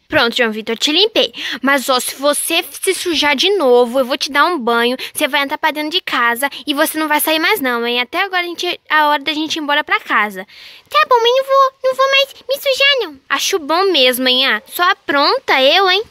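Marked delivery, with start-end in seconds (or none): 0.1-10.5 mother warns João Vitor to stay clean; scolding mom. Pretend. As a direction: threaten the kid with a bath, lightly stern.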